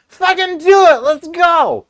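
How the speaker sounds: very high, full-volume holler; all-out hyped-up roar; rowdy drunken shout. Retold or imitated. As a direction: yell it like you're four beers in. Reenacts himself four beers in.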